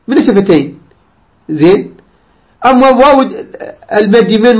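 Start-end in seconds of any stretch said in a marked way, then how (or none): none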